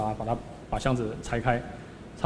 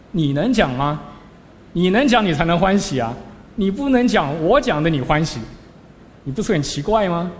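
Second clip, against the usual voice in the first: much higher and louder, a sharp scolding; stern rebuke; 5 semitones higher, 6 dB louder than usual. Retold or imitated. Master rebuking the disciple for enjoying a talk he cannot give himself, stern and loud. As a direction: jolt the student awake with it.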